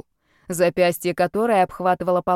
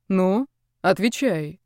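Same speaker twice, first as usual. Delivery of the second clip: slow statue voice; slower than usual. Acted. Spirit demands an answer.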